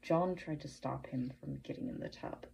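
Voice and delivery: usual speaking voice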